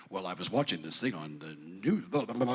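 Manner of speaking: low, droning imitation of a know-it-all son; analytical kid. Son explaining star counts to dad, mock-analytical drone. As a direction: mimic a child's dry analysis, dropping down low. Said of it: "like, analytical shit".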